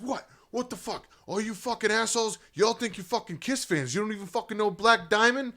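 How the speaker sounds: high, loud mock rant at the crowd; angry rocker. Rocker dad ranting at the Kiss crowd, loud and high. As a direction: rage at the kids like a drunk superfan. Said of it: "he's like".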